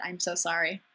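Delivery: usual speaking voice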